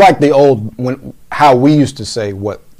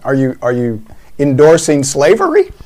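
ordinary talk, nothing marked

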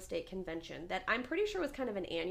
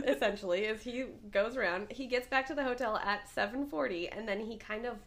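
ordinary talk, nothing marked

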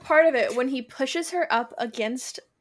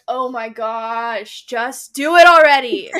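very slow "oh my gosh", then a loud "just do it already"; begging the characters to finally get on with it; exasperated plea; 12 dB louder, slower than usual. Retold or imitated. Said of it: "sometimes there's books where I'm like".

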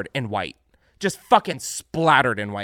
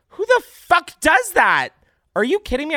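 outraged shout; 7 dB louder than usual. Real, not acted. Vlogger yelling about windshield attack, outraged shout. Outrage at being ranch bombed.

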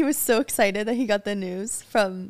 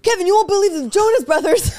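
much higher, louder, thrilled yell; excited call-out; 10 semitones higher, 5 dB louder than usual. Retold or imitated. Boyfriend calls out to Kevin while hanging up, thrilled. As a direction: shout it to a friend across the room. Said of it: "he goes".

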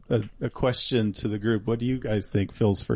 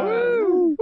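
very high, loud "woo"; big whoop of applause; celebratory whoop; 5 dB louder than usual. Real, not acted. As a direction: cheer with a high-pitched whoop. Cheering after the mouthed fanfare.

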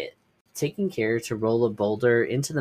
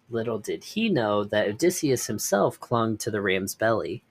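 plain reading voice

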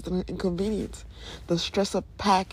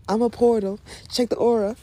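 excited; 4 semitones higher, 6 dB louder than usual. Real, not acted. Excited outburst after lyric.

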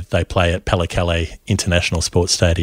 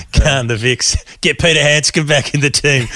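high-pitched fan's cheer; mock rallying cry; 6 semitones higher, 4 dB louder than usual. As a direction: call it out like a joking supporter. Mock fan campaign cry, laughing.

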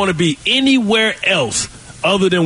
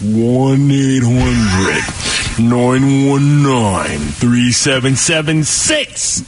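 very slow, drawn-out low digits; mock-patient slow motion, low, picking up toward the end; real slow; 5 semitones lower, much slower than usual. Shown as a demonstration. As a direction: stretch every number out as if for slow listeners. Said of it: "I'll say it for you real slow".

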